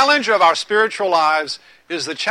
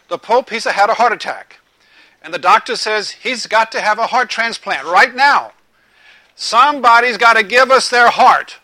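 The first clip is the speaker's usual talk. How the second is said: louder, raised public address to a square; cardinal's announcement; 5 semitones higher, 9 dB louder than usual. Retold or imitated. Cardinal at the microphone telling the crowd, loud announcement.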